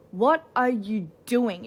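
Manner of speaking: slow, loud, drawn-out disbelief; exasperated what are you doing. Real, not acted. Scolding a friend who settles for crumbs, slow and loud. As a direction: stretch it out like you can't believe them.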